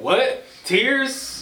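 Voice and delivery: high, sarcastic "What? Tears?" with a rising then falling tune; mocking disbelief. Host retelling LeBron's post, mocking disbelief. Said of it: "basically said".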